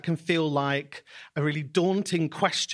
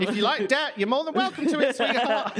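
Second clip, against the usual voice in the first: much higher, laughing through the words; teasing laugh; 9 semitones higher than usual. Real, not acted. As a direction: tease your co-host, giggling. Teasing banter with co-host.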